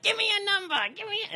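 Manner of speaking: imitating Eric. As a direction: throw in a high impression of Eric. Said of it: "when I do your voice".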